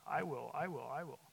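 "I" pressed on each "I will", in a put-on voice; stressed I's. Shown as a demonstration. Preacher showing God's repeated promise, stressing "I". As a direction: punch every "I" in "I will". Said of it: "he added all the inflections, the I's".

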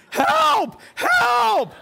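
very high, loud acted scream for help; terrified screaming. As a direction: scream in panic, pitch shooting right up. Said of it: "he's screaming".

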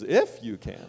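"If" jumps up, "you can" drops after it; inflection on "If". Shown as a demonstration. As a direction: question the "If" with a sharp lift. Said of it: "That the inflection is".

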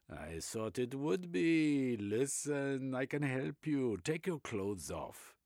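excitable German psychoanalyst, high and loud; German accent. Acted. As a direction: Reich in a German accent, eagerly expounding.

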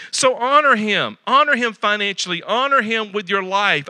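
preaching at full force. Acted.

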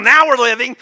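rally the congregation with it; rousing shout. Real, not acted. Same slogan shouted to congregation.

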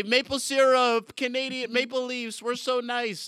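playing the polite Canadian for laughs. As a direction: ham up the polite Canadian cliche. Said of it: "stereotypes of our region".

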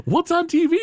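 put-on high, perky kid asking what's on; mock kid voice. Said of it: "Bart's like".

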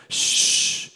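sustained whispery shush; hushing shh. Shown as a demonstration. Preacher demonstrating the expression to the church, hushing. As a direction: shush them with one long breath. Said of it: "It's an expression".

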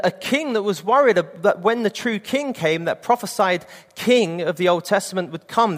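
ordinary talk, nothing marked

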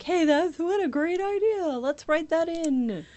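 put-on delighted writer; imagined eager writers. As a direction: pitch it up, as if hitting on a great idea. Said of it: "Or, like".

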